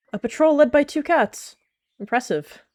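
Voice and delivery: high, sarcastic jab; mocking. Sister mocking the patrol, sarcastic and high. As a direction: sneer at the two leaders. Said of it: "she mocked".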